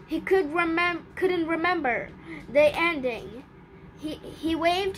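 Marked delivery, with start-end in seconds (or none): none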